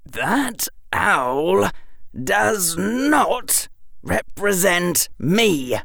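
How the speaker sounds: high, measured indignation; tightly controlled upset, word by word; shaking voice. A narrator's reading. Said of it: "Harry's voice was shaking".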